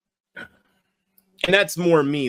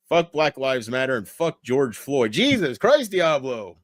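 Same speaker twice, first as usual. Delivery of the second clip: normal talking voice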